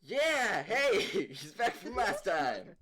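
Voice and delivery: loud, high welcome-back whoop; excited cheer. Real, not acted. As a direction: cheer loudly for a returning friend. Cheering a returning player.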